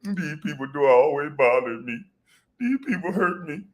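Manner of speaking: hammed-up self-pity; mock sad whine. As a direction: play the wounded victim, mocking. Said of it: "come back on camera looking sad".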